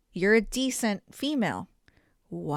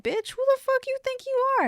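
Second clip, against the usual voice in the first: high, sassy retort; playful imitation of her own comeback; mock clapback. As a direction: snap back at her with attitude. Retorting to complaining commuter.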